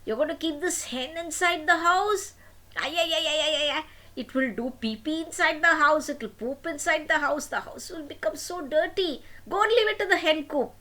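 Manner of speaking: high, shrill-pitched shocked mother; a mother aghast at a hen in the house; scandalised mother. Acted. Mother scolding her son about the hen, high and shocked. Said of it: "His mom said".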